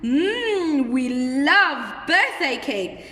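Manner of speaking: slow, relishing exclamation with a yummy hum; hum, then stretch it out with delight; acted happy excitement; excited and happy. Shown as a demonstration. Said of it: "I'm excited and that I'm very happy".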